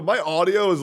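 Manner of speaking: normal talking voice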